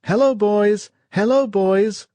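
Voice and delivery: high, loud, desperate cry; wounded man crying to the boys. Acted. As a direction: call out over and over. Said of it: "cried the wounded man".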